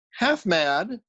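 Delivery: high, half-crazed insistence; raving journalist. A narrator's reading. Raving journalist insisting he is only half mad. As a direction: blurt it out like a madman.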